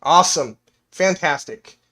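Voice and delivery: usual speaking voice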